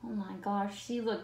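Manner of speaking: ordinary delivery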